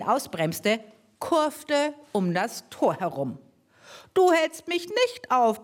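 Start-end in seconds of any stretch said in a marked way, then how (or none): 4.1-5.6 high, bellowed threat; roaring. Acted. Mower man roars at Mortimer blocking him, higher. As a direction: bark it at him, furious. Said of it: "brüllte er".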